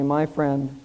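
low, slow, gentle reply; calm, measured reassurance; calm old man. Acted. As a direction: answer softly, letting each phrase settle. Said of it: "the old man said calmly".

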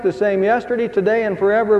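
ordinary talk, nothing marked

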